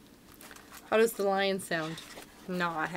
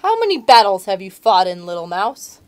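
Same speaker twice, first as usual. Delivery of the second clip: lion voice; 10 dB louder than usual. Acted. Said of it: "said Lion".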